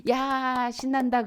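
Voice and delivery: high, loud mock cheer; gleeful child. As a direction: cheer like a kid let off the leash. 허락받고 신난 아이 흉내. (신나서 환호하는 아이 말투)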